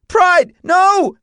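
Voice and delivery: very high, loud screech; panicked plea to the horse; screechy with fear. A narrator's reading. Boy bumped along ground screams at horse, fearful screech. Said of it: "His voice sounded screechy with fear".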